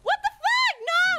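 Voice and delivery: high, loud cry of alarm; real panicked yell. Real, not acted. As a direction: scream it in sudden panic. Startled during the game.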